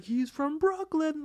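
high, drawn-out crowd hyping; band hype man. Retold or imitated. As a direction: imitate a band introducing its member, high and stretched. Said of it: "He's like".

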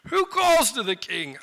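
slow, drawn-out groggy call; acted drowsy question; sleepy reply. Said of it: "I feel like he's sleepy".

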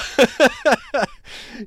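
high, loud burst of laughing; laughter. Real, not acted. Storyteller recalls being pranked, bursts out laughing. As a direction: crack up at the memory.

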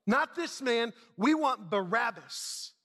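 crowd's shouted refusal, high on "No"; shouting back. Acted. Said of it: "they shouted back".

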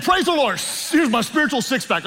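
high-pitched hammed-up praise; mock testimony. Pastor joking about getting saved and gaining abs, mock excited testimony. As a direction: exclaim it like an overjoyed new convert, joking.

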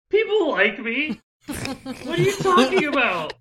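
high, loud, wounded wail; hammed-up tearful squeal; wailing mockery victim. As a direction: cry out in comic hurt disbelief. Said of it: "He's like".

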